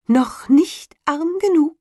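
high, slow, mocking bird voice; taunting sparrow. A narrator's reading. Sparrow mocking the ruined carter, high and slow. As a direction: sneer down at him like a spiteful little bird.

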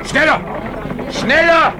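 urgent command. Acted. Ahab urges crew hauling him.

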